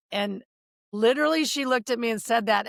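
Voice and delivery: usual speaking voice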